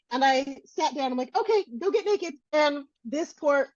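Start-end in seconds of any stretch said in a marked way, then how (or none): 1.4-2.3 mock quip. Speaker's blunt quip to nurse.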